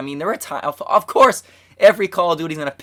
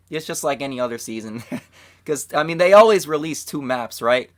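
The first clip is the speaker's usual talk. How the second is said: ordinary talk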